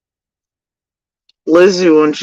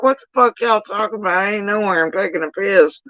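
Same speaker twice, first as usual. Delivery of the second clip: retold outrage; 9 semitones higher than usual. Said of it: "And I'm like".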